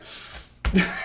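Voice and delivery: loud burst of laughter; big genuine laugh; real laugh. Real, not acted. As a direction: crack up at your own punchline. Laughs at his own punchline.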